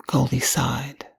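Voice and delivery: usual speaking voice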